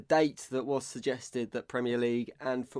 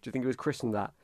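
ordinary talk

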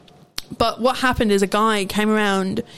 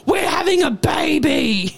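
slow, drawn-out mock scream, pitched up; retold screaming; 4 semitones higher, slower than usual. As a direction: imitate a deranged customer screaming her announcement. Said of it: "Then she starts screaming again".

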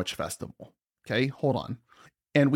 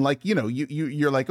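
normal talking voice

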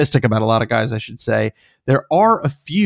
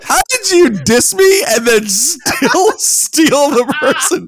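high, laughing disbelief; real laughing protest, much higher than usual; 12 semitones higher than usual. Real, not acted. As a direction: laugh through the complaint. Laughing at co-host's stolen pick.

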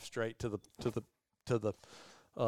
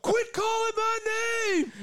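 high, loud, laughing shout; annoyed retold yell; 4 dB louder than usual. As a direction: yell back half laughing, pitched way up. Brooks yelling back annoyed.

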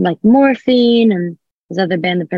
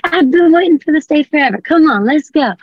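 excited; 9 semitones higher, 4 dB louder than usual. Retold or imitated. Eager kid agreeing to band.

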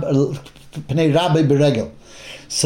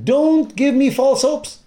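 higher and slow, begging; pleading; 9 semitones higher, much slower than usual. Acted. Bereaved mother reproaching the prophet, slow higher plea.

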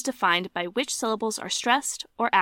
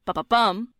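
"ba, ba, bum" landing hard on BUM; two light quick "ba"s, then a strong "bum"; weak, weak, strong on "ba, ba, bum"; stress on last beat. Shown as a demonstration. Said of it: "two unstressed followed by a stressed".

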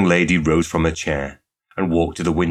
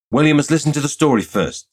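higher, louder insistence; persistent; 9 semitones higher, 5 dB louder than usual. A narrator's reading. Laura insists suitor hear the story, persistently. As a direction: press him to hear it out. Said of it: "said Laura persistently".

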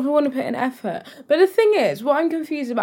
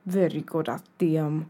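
put-on Halloween fancy-dress accent; 5 semitones lower, 7 dB quieter than usual. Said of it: "accent in honor of Halloween".

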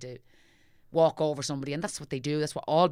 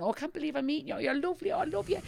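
higher, giddy fan gushing; gushing teen fan; 10 semitones higher, faster than usual. Retold or imitated. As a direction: play the starstruck teenager. Teen fans meeting her.

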